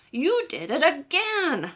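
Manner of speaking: slow exasperated scold. Acted. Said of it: "papa scolding".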